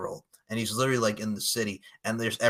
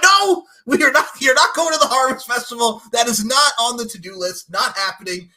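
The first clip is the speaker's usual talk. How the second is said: high, loud mock shouting of no; loud refusal; 9 semitones higher, 9 dB louder than usual. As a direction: shut the idea down hard. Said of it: "They're like".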